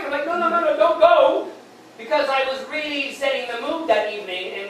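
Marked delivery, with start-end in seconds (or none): 0.3-1.5 higher, hurried mock panic; panicked pleading. Man to his departing date, panicked pleading. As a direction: plead in alarm, playing it up.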